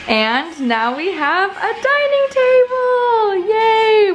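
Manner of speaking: high, drawn-out squeal of celebration; real excited cheer. Real, not acted. Vlogger showing off the new dining table, elated. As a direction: build slowly into a high, loud yay.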